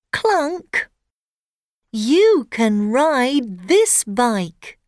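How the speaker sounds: put-on high, loud little girl; cheerful girl calling; young girl voice. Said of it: "says Rosie".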